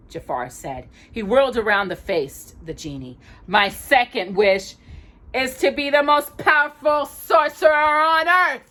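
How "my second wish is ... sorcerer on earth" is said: high, loud sorcerer's boast; gloating villain. Acted. Jafar makes his wish to the genie, loud gloating villain. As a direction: proclaim it like a power-mad sorcerer.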